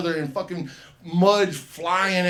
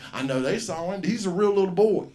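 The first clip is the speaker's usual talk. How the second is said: ordinary talk, nothing marked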